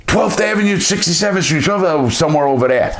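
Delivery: retold terrified confession, high then easing; panicked blurting kid. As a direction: blurt the address in a frightened high voice, then settle. Kid gives up the location.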